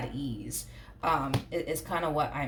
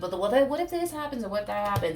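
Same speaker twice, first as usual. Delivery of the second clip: anxious worrying; 4 semitones higher, faster than usual. Retold or imitated. Anxious doubts voiced.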